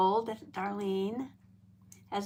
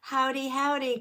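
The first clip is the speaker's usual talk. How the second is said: ordinary delivery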